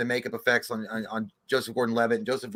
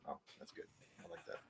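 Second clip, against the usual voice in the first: hushed, breathy aside; a guilty whisper; 10 semitones lower, 29 dB quieter than usual. Pretend. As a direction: whisper it as if sneaking food. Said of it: "You're like".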